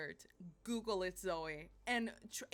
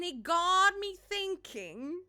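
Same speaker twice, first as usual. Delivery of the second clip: slow, higher, louder, with mock weight; dramatic musing; 8 semitones higher, 8 dB louder, slower than usual. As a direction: draw it out like a big revelation. Dramatic repeat of a phrase.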